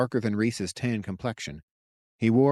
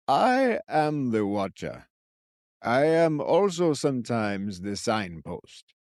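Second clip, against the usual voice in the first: put-on old man voice; slower than usual.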